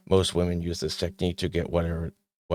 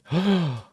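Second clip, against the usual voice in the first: mock gasp; 10 semitones higher than usual. Mock horror at silent treatment.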